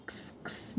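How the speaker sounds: क्स voiceless and breathy, only the k and s sounds; phonics sound demo. Shown as a demonstration. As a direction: breathe out क्स with no vowel after it. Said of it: "एक्स का sound होता है क्स".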